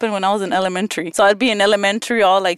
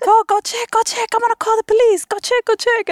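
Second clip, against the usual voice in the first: mock panicked sisters; 13 semitones higher than usual. Sisters urging her to check.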